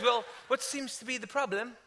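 put-on British lilt, rising at the end; British accent. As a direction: imitate a polite British office woman. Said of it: "She's British".

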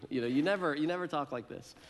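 ordinary talk, nothing marked

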